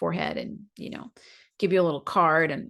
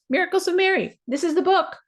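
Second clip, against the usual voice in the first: excited; 10 semitones higher than usual. Shown as a demonstration.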